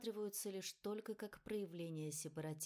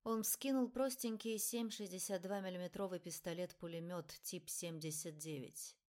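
plain reading voice

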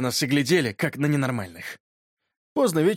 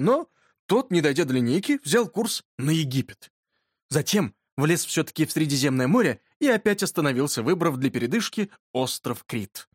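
usual speaking voice

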